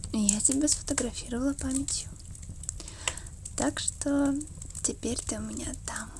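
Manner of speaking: ordinary delivery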